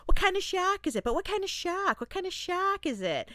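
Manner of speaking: high, light imitation of a fisherman asking; feminine voice. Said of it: "had a very feminine".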